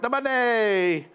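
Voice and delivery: slow, drawn-out put-on voice; mock Loadsamoney drawl, very slow; Harry Enfield's Loadsamoney. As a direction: stretch it out like a cocky catchphrase. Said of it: "so I went".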